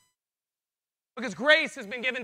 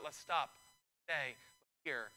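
softer, lower, with pauses between words; settled, halting call; 5 semitones lower, 7 dB quieter, slower than usual. Acted. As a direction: bring everything to a calm standstill. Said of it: "prophetic churches are all about".

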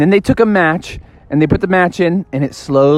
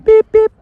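very high, louder beep imitation; remote beep; 6 dB louder than usual. Car remote chirping as the alarm is turned off, high beep. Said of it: "maybe you could disactivate the alarm".

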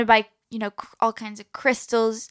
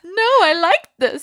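higher, slow pleading objection; playful protest; 8 semitones higher, 3 dB louder, slower than usual. Real, not acted.

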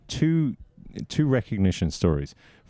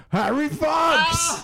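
put-on high, drawn-out drunken holler; imitating a drunk guy's long, high shout; drunk heckler; much slower than usual. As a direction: yell it like a drunk party pest. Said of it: "this fucking drunk dude who just kept coming up".

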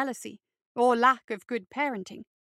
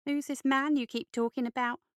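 ordinary delivery, nothing marked

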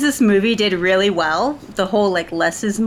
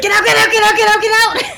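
very high, loud, rapid-fire shouting; retold frantic yell; 13 semitones higher, 8 dB louder than usual. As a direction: reenact shrieking everyone out of the room. Reenacting shooing students out during birth.

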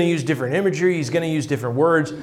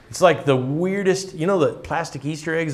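normal talking voice

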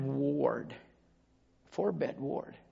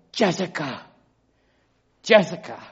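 mildly raised call of a name; 3 semitones higher, 5 dB louder than usual. Retold or imitated. Preacher re-enacts calling to his wailing roommate, calling out. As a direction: call across to someone nearby, re-enacted.

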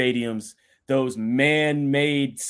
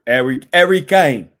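a hint of a posh English butler on the name; Alfred-style English accent; 3 semitones higher, 10 dB louder than usual. Sports host doing Alfred's English accent on Harry Kane. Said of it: "I'm Alfred from Dark Knight".

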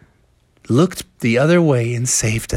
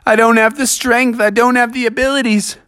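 higher-pitched storybook bear protesting; dismayed Bubby; 8 semitones higher than usual. A narrator's reading. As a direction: plead helplessly, pitched up. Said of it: "And Bubby said".

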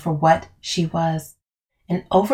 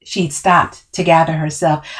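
usual speaking voice

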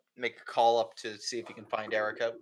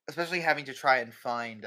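normal talking voice

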